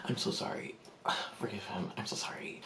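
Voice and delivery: hushed, embarrassed whisper; whispered apology. Retold or imitated. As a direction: whisper apologies on a friend's behalf. Apologising discreetly to passers-by.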